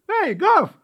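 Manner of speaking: high loud call. Acted. Puppy calls Gav to come play, loud. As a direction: call him over loudly. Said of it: "позвал щенок".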